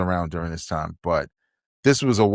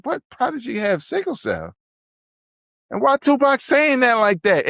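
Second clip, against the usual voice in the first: baffled outrage pitched up; incredulous. Retold or imitated. Learning about Prodigy from the song: high incredulous questions. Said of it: "I was like".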